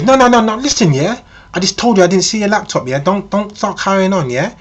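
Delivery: high, loud, indignant mock-female outburst. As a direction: act a woman angrily shutting down an accusation. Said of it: "she starts raising her voice, she starts shouting".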